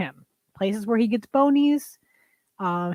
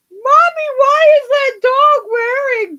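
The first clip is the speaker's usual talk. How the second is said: put-on much higher, slower, loud whiny child voice; little kid; 11 semitones higher, 8 dB louder, slower than usual. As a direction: imitate a confused child calling mommy. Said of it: "that little kid was like".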